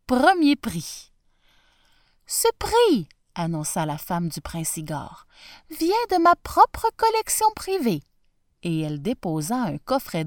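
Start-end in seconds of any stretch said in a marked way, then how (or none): 2.3-3.1 announcing princess. Acted. 5.7-8.0 announcing princess. Acted.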